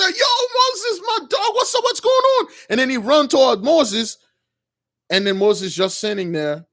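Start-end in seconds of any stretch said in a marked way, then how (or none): 0.0-2.5 very high-pitched, excited acted greeting; joyful greeting. Prince jumps up greeting his long-lost friend Moses, joyful. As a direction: burst out in a high voice of delighted welcome. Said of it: "with the joy".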